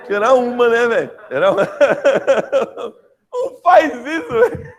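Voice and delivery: loud, high, breaking up with laughter; laughing hard. Real, not acted. Teacher cracking up at a student's question, helpless loud laughter. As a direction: protest helplessly through real laughter.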